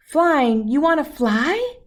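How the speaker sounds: guessing parent. Acted. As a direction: guess eagerly at the baby's meaning.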